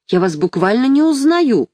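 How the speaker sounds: мягко изображённое изумление, чуть выше; чуть повысь голос от удивления; лёгкое восклицание. A narrator's reading. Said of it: "воскликнула Марья Павловна". (с лёгким удивлением)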